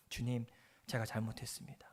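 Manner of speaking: soft, low whispered apology; humbled man whispers his repentance to the Lord; whispered confession. Acted. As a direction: breathe the repentance out, barely voiced. Repenting, fallen on his face.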